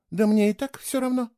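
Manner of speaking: ordinary delivery, nothing marked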